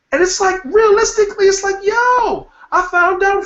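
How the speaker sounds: high, loud exasperated yell; excited outburst. Real, not acted. Ranting about avocado health claims.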